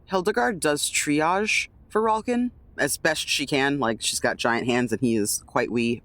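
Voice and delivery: ordinary talk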